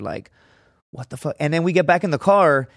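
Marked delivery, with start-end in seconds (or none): 0.9-1.4 hushed, stunned aside; inner thought. Retold or imitated. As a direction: say it under your breath, as if thinking. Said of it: "I'm thinking in my head".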